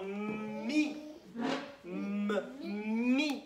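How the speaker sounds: "Mi", then "M" alone, then "Mi", M held; syllable broken down. Shown as a demonstration. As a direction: stretch the M so each sound in "Mi" stands out. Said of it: "qu'est-ce que j'entends". (« Mi » décomposé, M allongé)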